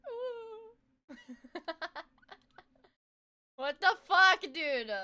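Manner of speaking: ordinary talk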